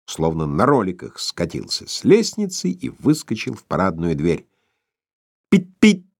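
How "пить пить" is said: high, loud honk of a horn; motorcycle horn. A narrator's reading. Motorcycle horn blares outside the glass. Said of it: "закричала за стёклами мотоциклетка".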